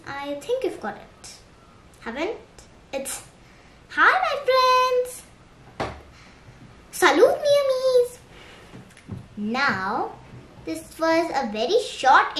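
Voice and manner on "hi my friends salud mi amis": sing-song greeting. Shown as a demonstration. Saying hi to my friends, sing-song. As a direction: hail friends in a high lilt.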